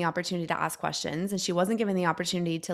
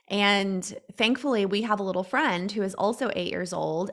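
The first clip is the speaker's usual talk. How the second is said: ordinary talk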